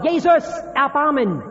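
laut und hoch gerufen, flehend; verzweifelter Schrei. Acted. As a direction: schrei nach Jesus um Erbarmen, mit aller Kraft. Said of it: "sondern er schreit umso lauter". (der blinde Bettler schreit über die Menge hinweg zu Jesus, laut flehend)

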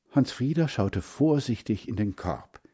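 ordinary delivery, nothing marked

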